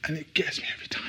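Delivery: breathy hushed whisper; whispered aside. Real, not acted. Preacher to congregation, a whispered aside. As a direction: drop to a whisper, moved.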